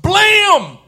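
sudden high, shouted "blam"; loud impact noise. Retold or imitated. Replaying the body slam, explosive shout.

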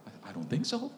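quiet, sheepish reply; meek reply. Retold or imitated. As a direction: answer small and meek.

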